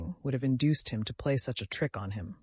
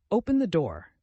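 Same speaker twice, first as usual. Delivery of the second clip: hurried shout; 5 semitones higher, 5 dB louder than usual. A narrator's reading. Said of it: "hurriedly shouted to Smirnov".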